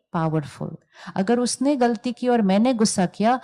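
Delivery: normal talking voice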